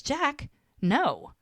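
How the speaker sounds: high-pitched, mildly acted protest; exasperated refusal. Woman refusing her pleading ex, exasperated. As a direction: push him away in a retold quarrel.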